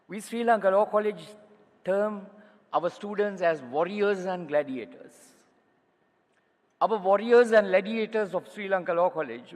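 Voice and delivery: loud, proud, declaiming to the hall; rousing oratory. Real, not acted. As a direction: raise the voice with pride. Rousing speech climax.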